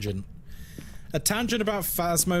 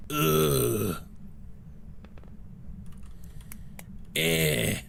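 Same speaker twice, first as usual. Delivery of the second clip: Scottish attempt; 3 semitones lower, much slower than usual. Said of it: "Great Scottish attempt there".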